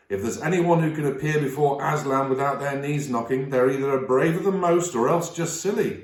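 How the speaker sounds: a motherly beaver wife, cheerfully blunt; Missus Beaver. Acted. Missus Beaver warns the children about the lion, homely and blunt.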